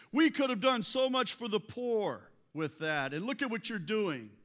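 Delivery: loud, high, indignant lecture; self-righteous outrage, acted. Preacher playing men who rail at the woman, self-righteous outrage. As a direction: rail at her from the moral high ground. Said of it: "try to be all self-righteous".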